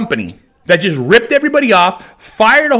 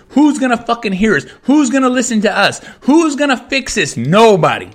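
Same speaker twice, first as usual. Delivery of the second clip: usual speaking voice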